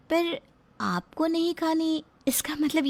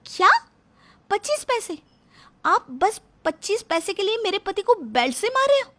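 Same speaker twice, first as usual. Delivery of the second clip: high, incredulous outburst; can't believe it's about pennies; shocked disbelief; 8 semitones higher than usual. Acted. Daughter-in-law to father-in-law, stunned that the beating is over pennies, incredulous.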